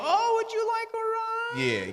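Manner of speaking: very high, slow, sugary mock eagerness; eager mock offer. Man mimics a guy falling for the hint, very high eager offer. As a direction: squeak it like an overeager pushover. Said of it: "And then you go".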